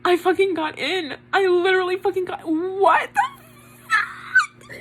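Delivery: high, tearful squeal of disbelief; real overjoyed shock, laughing and crying; held-back scream. Real, not acted. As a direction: build into a stifled shriek on the last word. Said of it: "I have to scream and I can't".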